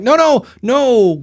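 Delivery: alarmed no. Retold or imitated. Host warns a listener off old gear, alarmed. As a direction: stop them in alarm.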